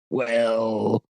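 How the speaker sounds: put-on slurry drunk-man reply. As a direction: answer like a tipsy witness. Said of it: "Very drunk".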